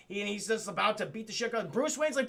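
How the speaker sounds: normal talking voice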